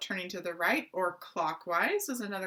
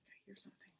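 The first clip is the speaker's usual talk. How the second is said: very soft stage whisper; hushed whisper; 22 dB quieter, much faster than usual. Pretend. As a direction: whisper it as if listening out. Hears monkey, pretends to listen.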